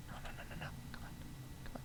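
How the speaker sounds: urgent, voiceless whispered pleading; whispering. Real, not acted. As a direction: whisper nervously as if a sound could spoil it. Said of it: "Why am I whispering?".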